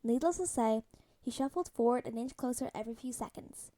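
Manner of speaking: ordinary delivery, nothing marked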